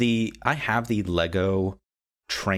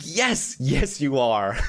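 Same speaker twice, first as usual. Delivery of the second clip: gushing agreement; 5 semitones higher, much slower than usual. Retold or imitated. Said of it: "I'm like".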